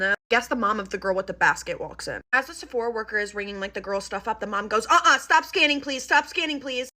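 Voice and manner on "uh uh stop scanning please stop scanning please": higher, firm interruption; insistent, no-nonsense request; curt mom. Retold or imitated. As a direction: imitate a mom cutting the cashier off. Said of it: "Literally just like that".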